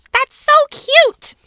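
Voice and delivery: very high, slow, gushing teacher; Miss Denver voice. Acted. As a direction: squeal it like a delighted teacher. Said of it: "That's what Miss Denver sounds like".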